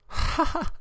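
high breathy "ha ha"; short, high acted cackle; scornful laugh. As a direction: laugh off the question. Dante laughs at the question.

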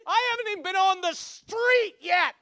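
loud, high-pitched indignation; exasperated outburst. Retold or imitated. Truck owner protesting about his new truck, loud indignant squawk. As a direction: protest it at the top of the voice.

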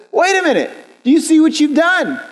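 high-pitched, affronted objection; indignant protest. Acted. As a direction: play the offended friend, pitched well up. Acted indignant friend in story.

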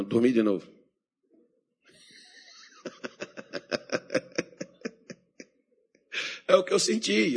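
ordinary talk, nothing marked

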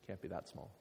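normal talking voice

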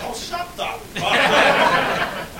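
high, slow drawn-out Australian scoff; slow laughing Australian put-down; Aussie dismissal. Retold or imitated. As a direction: sneer it like a brash Aussie. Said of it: "the Aussie in me kicked in".

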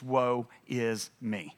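very slow, low and soft; humbled lament. Acted. Isaiah realizing himself before holy God, humbled lament. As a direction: draw out each word, sinking low.

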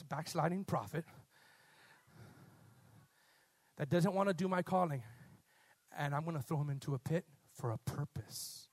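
low, soft, composed commanding voice; quiet creator giving orders; God addressing a fish. Acted.

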